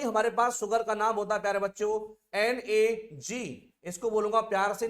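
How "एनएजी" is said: slow drilled letters. Shown as a demonstration. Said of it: "teacher drills the abbreviation".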